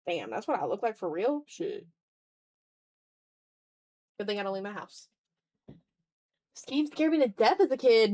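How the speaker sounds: ordinary talk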